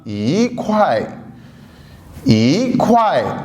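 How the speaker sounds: ई ख्वाए with ई rising, ख्वाए falling; ई in second tone before ख्वाए; yí kuài tone demo. Shown as a demonstration. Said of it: "ई ख्वाए की बजाय क्या हो जाएगा".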